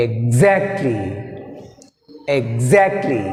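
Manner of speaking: low ex, then jump onto ZACT in "exactly"; second-syllable stress. Shown as a demonstration. Teacher demonstrating the next example, "exactly" stressed on ZACT. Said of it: "stress on second syllable".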